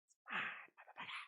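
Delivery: a breathy boast under the breath; sly whisper. Acted. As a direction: whisper it, gloating and possessive. Gloating over his KOM record.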